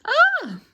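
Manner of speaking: high loud scream; a boy's panicked scream down the slide. Acted. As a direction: let out a sudden high terrified scream. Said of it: "and screamed".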